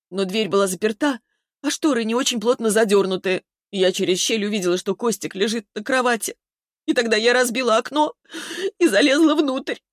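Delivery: high, agitated retelling; distraught confession. A narrator's reading. Distraught Vera confesses her climb.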